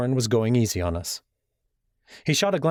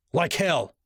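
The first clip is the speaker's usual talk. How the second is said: higher, sharp defiant snap; snapped retort; 7 semitones higher than usual. Acted. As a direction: throw it back at him with contempt. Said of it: "he snapped".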